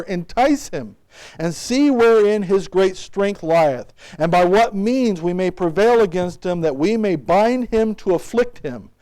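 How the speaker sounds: loud, measured King James reading; scripture reading. Real, not acted. Preacher reads scripture aloud to his church, loud and measured. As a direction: proclaim the verses to the congregation.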